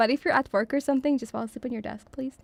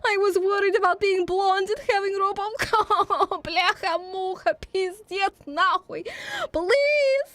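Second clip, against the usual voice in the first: shrill panicking pleading; 7 semitones higher, slower than usual. Pretend. High panicked comic voice.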